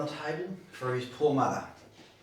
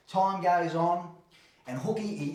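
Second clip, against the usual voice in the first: usual speaking voice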